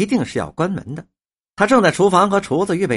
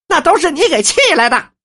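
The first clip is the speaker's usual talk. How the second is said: high, loud burst of blame; storyteller's acted indignant outburst; angry accusation; 11 semitones higher, 5 dB louder than usual. A narrator's reading. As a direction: throw the blame straight at the listener. Wife's ally berates unfaithful husband.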